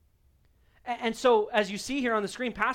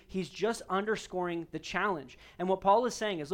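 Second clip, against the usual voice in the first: ordinary talk, nothing marked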